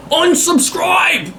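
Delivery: hammed-up slow yell of a viewer quitting; mock angry shout. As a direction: bellow it like a huffy viewer storming off.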